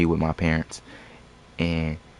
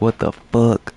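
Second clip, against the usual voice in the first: sharp, louder protest, higher pitched; indignant complaint about stolen money; retold angry outburst; 5 semitones higher, 4 dB louder than usual. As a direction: snap it out like your pay just got taken. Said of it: "I'm salty as hell".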